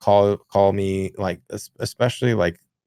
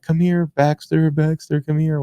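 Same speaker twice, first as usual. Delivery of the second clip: mock screaming a dog's name; 7 semitones higher than usual. Lady chasing her loose dog, mock calling out. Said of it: "screaming the dog's name".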